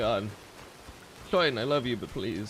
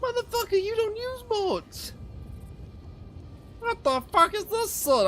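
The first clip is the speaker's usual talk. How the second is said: exasperated high yell. Real, not acted. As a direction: vent at him in disbelief. Exasperated at shop owner.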